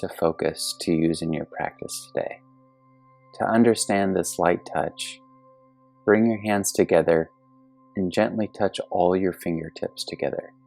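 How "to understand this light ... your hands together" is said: usual speaking voice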